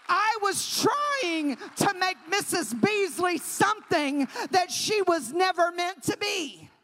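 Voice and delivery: loud, driving sermon; loud preacher declaring; full-force preaching. Acted.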